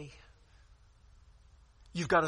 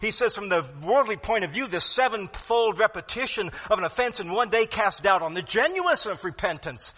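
fervent preaching; 6 semitones higher, 9 dB louder than usual. Acted. Preaching at full force.

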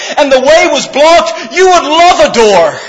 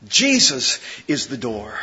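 much softer and lower, almost to yourself; hushed repeat; 13 semitones lower, 12 dB quieter than usual. Shown as a demonstration. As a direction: let the words "Jesus is the door" sink into a hush.

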